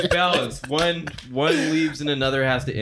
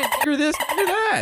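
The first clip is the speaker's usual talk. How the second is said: high-pitched mocking voice, much higher; mock them in a raised, jeering voice; mock quote; 12 semitones higher than usual. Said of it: "walking around saying".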